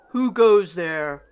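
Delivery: loud, slow challenge from a knight; knight's loud cry. A narrator's reading. Knight challenging the visitors at the door, a loud slow cry. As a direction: boom it out like a guard at the gate. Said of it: "one knight cried".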